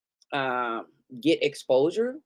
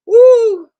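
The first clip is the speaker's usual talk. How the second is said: loud, high whoop of relief; 15 dB louder than usual. Real, not acted. Vlogger whooping about the kids' visit being over. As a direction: let out a big high woo.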